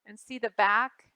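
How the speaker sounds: plain reading voice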